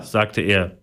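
usual speaking voice

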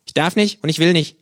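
high, loud cheeky kangaroo greeting. Acted. The kangaroo scolds the late narrator in its high cheeky voice. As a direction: snap it out as the brash kangaroo.

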